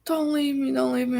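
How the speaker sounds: high mock plea; theatrical begging. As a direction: imitate fake desperation. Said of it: "it was quite theatrical and fake".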